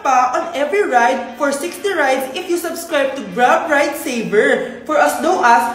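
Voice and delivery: upbeat sponsored pitch, loud; ad read. Acted. Friend pitches Grab saver fares to broke friend, loud ad read. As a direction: sell the Grab deal brightly.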